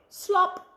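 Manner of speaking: high, punchy splat word; bright sound-effect slop. A narrator's reading. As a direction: pop it out like a splash.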